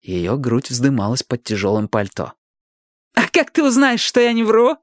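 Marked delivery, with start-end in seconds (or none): 3.1-4.8 high-pitched defiant challenge; shrill-edged taunting question. A narrator's reading. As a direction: throw it at them as a dare. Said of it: "с вызовом бросила она".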